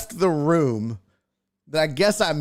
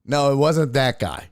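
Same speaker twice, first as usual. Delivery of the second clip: usual speaking voice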